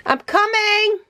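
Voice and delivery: high, sing-song call to another room. Real, not acted. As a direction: call back that you're on the way. Calling back to someone.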